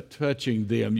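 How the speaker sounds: usual speaking voice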